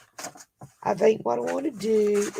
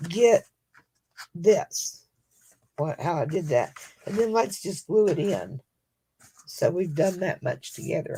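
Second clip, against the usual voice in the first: ordinary talk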